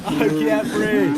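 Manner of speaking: drawn-out high mock gasp; hammed-up breathless plea; mocking imitation. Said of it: "we got George Floyd over here".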